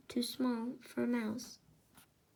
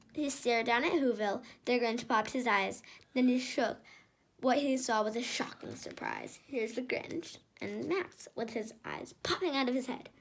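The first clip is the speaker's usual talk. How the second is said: usual speaking voice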